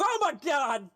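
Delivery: high, loud cry of shock; acted fright at a monster; startled bar hand. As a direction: yelp it out, suddenly terrified. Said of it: "He turns around and goes".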